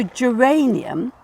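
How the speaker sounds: "geranium" with a soft J sound, slowly. Shown as a demonstration. Teacher showing a student the soft G: "geranium". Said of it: "it's before E, so it's soft".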